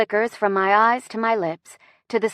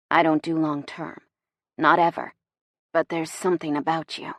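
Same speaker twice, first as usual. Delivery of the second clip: intense male love interest; 3 semitones lower than usual. Acted. Man offering her one night only, intense and restrained. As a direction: keep it low-key and serious.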